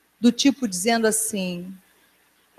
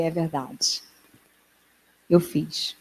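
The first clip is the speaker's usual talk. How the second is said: quiet admission; 7 semitones lower, 7 dB quieter, slower than usual. Retold or imitated. Preacher admits to God.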